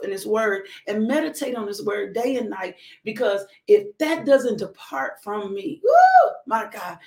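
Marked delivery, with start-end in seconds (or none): 5.8-7.0 much higher, louder, slow exclamation; a rapturous shout to God; ecstatic outcry. Real, not acted. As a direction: let the praise burst out. Preaching climax, overcome.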